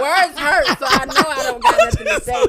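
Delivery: high, loud laugh through the words. Real, not acted. Laughing off the host's request to cry. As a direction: crack up mid-sentence.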